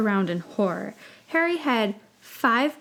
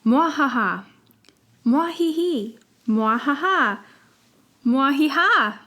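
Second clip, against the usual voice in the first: mad scientist; 5 semitones higher, 5 dB louder than usual. Acted. The laugh lesson continues.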